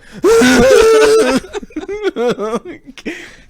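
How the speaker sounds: long, loud, very high squeal; high mock wail. Performer's face on the inside of the suit, high mock wail. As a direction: let out a piercing comic wail. Mascot performer's hidden inner reaction.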